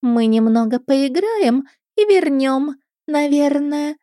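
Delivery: put-on high, slow girlish drawl; languid mermaid. A narrator's reading. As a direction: toy with the listener, stretching the words lazily. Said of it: "томно протянула вторая". (томный протяжный голос русалки)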